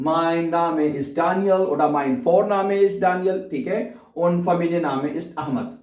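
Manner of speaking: slow, careful German sentences; slow demo. Shown as a demonstration. Teacher reviewing first and family name, slowly. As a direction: model it slowly for beginners. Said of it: "Vorname कहते हैं".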